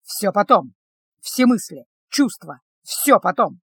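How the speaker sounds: firm, punchy commander's order; growled order. A narrator's reading. Commander orders guardsman to put feelings off, growling. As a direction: push each phrase out firmly. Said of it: "рыкнул командир".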